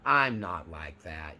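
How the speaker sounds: low, slow, brushing it off; mock dismissive denial. Grandiose narcissist shrugging off criticism, lightly dismissive. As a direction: sniff at the accusation, unbothered. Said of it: "will just let it all roll off their shoulder".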